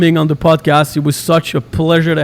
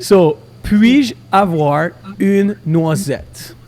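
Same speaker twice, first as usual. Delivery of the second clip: very slow, syllable by syllable; over enunciating; much slower than usual. Shown as a demonstration. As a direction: stretch out every sound for the learner.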